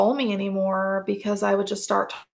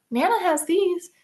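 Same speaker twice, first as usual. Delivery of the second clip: much higher, sweet doting grandmother voice; doting Nana; 9 semitones higher than usual. Retold or imitated. Nana offering her granddaughter morphine for a headache, high and cooing. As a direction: coo it up high like a grandma spoiling her baby. Voicing Nana, corrected name.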